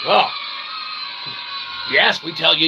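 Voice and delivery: ordinary talk, nothing marked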